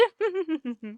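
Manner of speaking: a soft, knowing little laugh, pleased with herself. Acted. Mrs Conklin amused after lecturing Miss Brooks, soft knowing laugh. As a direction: chuckle at your own romantic theory. Gossiping wife amused by her theory.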